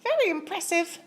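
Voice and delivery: a small bright-eyed mouse piping up; tiny mouse voice. Acted. Polite mouse asks Bear for cheese, squeaky high. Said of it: "exclaimed the mouse".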